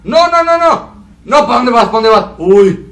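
frantic shouts at the game; panicked yelling. Real, not acted. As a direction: yell in alarm as you get chased. Panicking as character gets chased.

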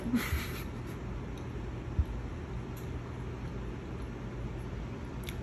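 breathy laughter. Real, not acted. Laughing over huge bite.

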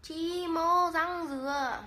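very slow Hà Tĩnh phrase, "mô" stretched; slow, careful Hà Tĩnh accent demo; Hà Tĩnh dialect. Shown as a demonstration. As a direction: spell out the Hà Tĩnh words carefully. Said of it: "bắn tiếng Hà Tĩnh cho chị em nghe".

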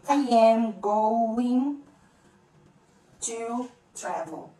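every word pulled apart, very slow; slow careful version. Shown as a demonstration. English teacher showing the full form before linking it, very slow and separated. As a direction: stretch it out word by word. Quando eu falo isso rápido.

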